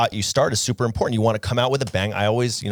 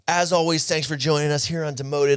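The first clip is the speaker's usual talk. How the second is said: higher, upbeat host outro voice; classic show sign-off; 6 semitones higher, slower than usual. Retold or imitated. Podcaster parodying their usual outro, chirpy host voice. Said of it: "Instead of our classic".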